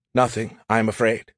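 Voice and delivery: ordinary talk, nothing marked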